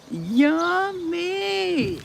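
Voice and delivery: high, squeaky cartoon "Yummy"; a tiny voice of pure delight. Savouring the orange on stream.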